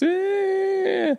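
high-pitched mock crying whine; imitated child's wail. Father mimics daughter bursting into tears, imitated wail. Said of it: "she bursts into tears".

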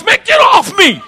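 high, explosive shout; angry. Acted. Josh reacting to a hit on his wound, angry shout. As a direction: shout him off you. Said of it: "And Josh is angry".

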